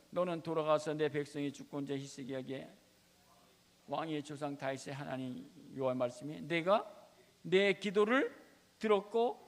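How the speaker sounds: loud, rising proclamation; full-force preaching. Real, not acted. Preacher proclaiming God heard the tears, full force. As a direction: proclaim God's answer triumphantly.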